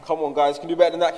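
loud, high call to a crowd. Real, not acted. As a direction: rouse the crowd. Urging audience to answer louder.

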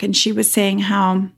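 usual speaking voice

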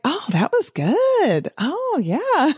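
high, pleased, slow pat on the back; mock self-praise. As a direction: sound impressed with your own episode. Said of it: "I'll be like".

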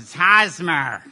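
slow, matter-of-fact correction; a stranger setting him straight, slowly; dry correction. Retold or imitated. Guy in front corrects pronunciation.

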